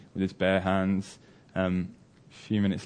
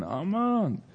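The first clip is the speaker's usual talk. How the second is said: high, falling call without real volume; stretched-out searching call, held back; mock shouting; 10 semitones higher than usual. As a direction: imitate shouting from afar for a son. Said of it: "shouting for his son".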